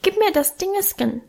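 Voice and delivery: put-on high-pitched small child begging; tiny high voice, asking; high little-boy voice. A narrator's reading. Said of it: "bat mit seinem hohen Stimmchen".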